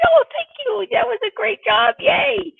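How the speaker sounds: very high, loud squeal of praise; over-the-top sing-song delight for a small child; gushing, excited. Shown as a demonstration. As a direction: gush over a little kid. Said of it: "you may be gushing, you might be so excited".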